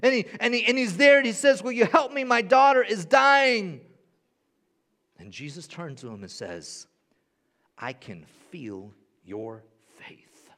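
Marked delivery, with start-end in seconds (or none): 1.6-3.8 much higher, louder desperate begging; desperate plea. Acted. Jairus begs Jesus. 7.8-10.5 slow, softer, lower, tender; gentle assurance. Acted. Jesus answers Jairus gently.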